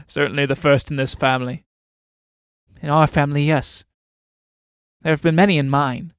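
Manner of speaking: ordinary delivery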